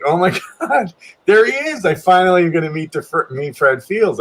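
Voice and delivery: high, giddy re-enactment with a laugh; starstruck excitement. Retold or imitated. Storyteller spots idol coming down the escalator, starstruck. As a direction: relive a fan's thrill, pitch jumping up. Retold thrill at spotting his idol.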